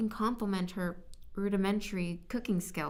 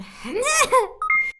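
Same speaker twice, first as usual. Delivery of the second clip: high, loud helpless laugh; a shrieking fit of giggles; burst of laughter; 10 dB louder than usual. Real, not acted. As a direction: crack up at your own flub. Cracks up after fumbling line.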